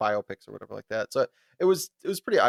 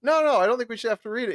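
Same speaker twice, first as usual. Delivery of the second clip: higher, fast protesting student voice; pitched-up mock student protest; upset student; 10 semitones higher, 4 dB louder, much faster than usual. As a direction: rush the objection in a pitched-up whine. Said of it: "She's like".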